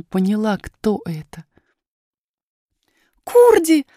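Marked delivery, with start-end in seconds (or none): 3.2-3.9 put-on high, loud girl's cry of delight; delighted, high-pitched call; joyful exclamation. A narrator's reading. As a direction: call out happily to a friend you've found. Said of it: "воскликнула она обрадованно".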